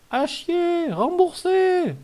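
high, drawn-out heckler's chant; mock crowd jeer. Streamer demanding a refund for the pack, high jeering chant.

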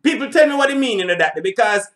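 normal talking voice

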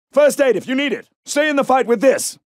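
ordinary talk, nothing marked

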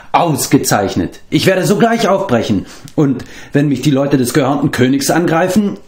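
a cheerful, bragging storyteller's bard; boastful bard. A narrator's reading. Bard Flefdur boasts to Taran, lightly voiced and eager.

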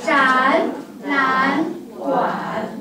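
展览馆 read very slowly, 展 and 览 both rising; 展览馆 drawn out syllable by syllable like a model reading; tone sandhi demo. Shown as a demonstration. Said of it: "那么怎么读？".

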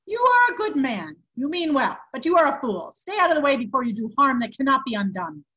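loud, high, forceful reproof; stern rebuke. A narrator's reading. Monk warns a well-meaning fool away, stern rebuke. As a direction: tell him off emphatically.

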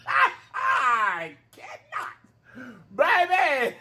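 high squealing laughter; cracking up at the food in her face; giggling shriek of surprise; laughing fit. Real, not acted.

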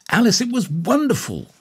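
put-on very high-pitched girlish delight; excited cry. A narrator's reading. Girl hugs her friend after the performance, crying out excitedly. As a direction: cry out in high, thrilled admiration. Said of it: "cried the girl".

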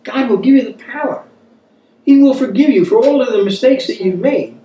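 ordinary delivery

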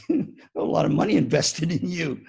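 normal talking voice

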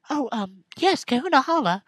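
put-on squeaky young girl reading her dialogue; girly game character. Voices Lily again.

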